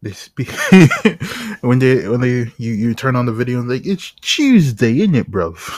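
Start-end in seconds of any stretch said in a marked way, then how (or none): 3.9-5.8 mock British accent. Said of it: "speaking in a British accent".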